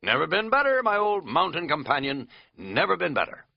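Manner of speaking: loud, high, overeager cheer; giddy overexcited. Acted. Katz answering the narrator, giddy and overexcited. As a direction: gush it like you're oddly wired. Katz oddly energized reply.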